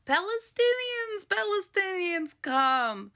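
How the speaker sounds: high, sing-song calling out; mock welcoming call. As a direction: call them in sweetly, very high.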